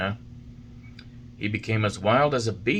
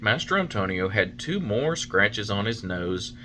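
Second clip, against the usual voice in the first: plain reading voice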